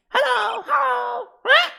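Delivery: very high, loud squawking parrot. Acted. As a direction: screech it like a parrot. Voicing the parrot Polly.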